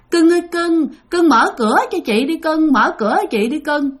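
high imitation of a ghost woman calling; ghostly coaxing call. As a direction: coax someone to open up, pitched high. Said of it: "bả kêu vậy nè". (giọng ma nữ gọi cửa cao, dỗ ngọt)

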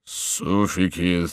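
low, very slow mumble; slurry slow drunken murmur; tipsy muttering. A narrator's reading. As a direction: mutter it drawn out, wine taking hold. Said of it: "пробормотал он".

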